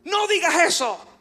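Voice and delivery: high-pitched, urgent command; retold inner shout. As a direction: cut in sharply to silence yourself. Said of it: "mi corazón me gritaban". (grito interior agudo y urgente)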